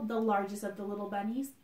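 plain reading voice

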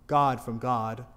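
ordinary delivery

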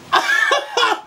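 short high cackle; real giddy laugh. Real, not acted. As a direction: let out a quick high laugh. Laughing as video ends.

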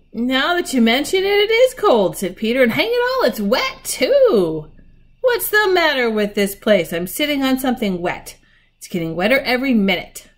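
loud, high-pitched grumbling; exasperated discovery. A narrator's reading. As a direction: exclaim in growing discomfort. Peter finds it cold and wet.

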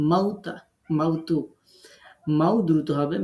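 ordinary talk

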